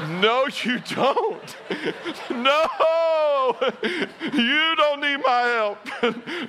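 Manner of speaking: high, slow, laughing no; laughing refusal. Retold or imitated. Husband to the nurse during birth, a high laughing refusal. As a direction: laugh the request away nervously.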